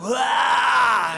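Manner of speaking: sudden loud, high retching wail; retching yell. Acted. Sick drunk answering a New Year greeting, retching yell. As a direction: heave out one big open-throated yell.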